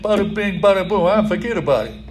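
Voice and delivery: put-on tossed-off New York accent gag; playful hint of a New York accent. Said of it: "talk with a New York accent".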